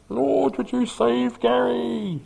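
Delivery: high, slow pleading prayer; imitating neighbours praying for Gary; mock prayer voice. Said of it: "They'd be like".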